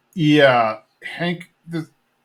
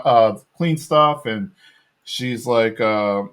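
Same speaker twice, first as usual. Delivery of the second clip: normal talking voice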